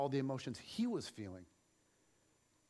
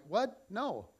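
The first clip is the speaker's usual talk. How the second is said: startled protest; 5 semitones higher, 4 dB louder than usual. Retold or imitated. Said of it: "And I'm like".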